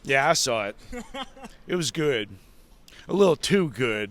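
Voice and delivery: mildly annoyed, unimpressed retort; Michael Hayes. Retold or imitated. Colleague annoyed at being stopped, mildly annoyed. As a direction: imitate a begrudging, irritated reply. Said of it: "looks annoyed that we stopped him".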